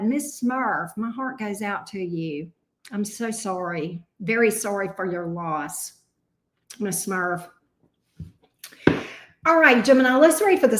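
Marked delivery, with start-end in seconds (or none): 0.0-7.5 tender condolences. Real, not acted. Condolence to viewer in chat.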